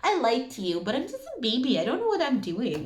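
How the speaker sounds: high, whiny baby voice playing dumb; mock a grown-up pleading they're just a baby; helpless baby voice. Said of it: "It's just like".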